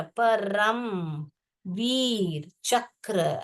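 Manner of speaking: stretched out slowly syllable by syllable; slow drawn out. Shown as a demonstration. Teacher drawing out Paramvir चक्र for the class. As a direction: draw the name out for them. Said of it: "teacher stretches word".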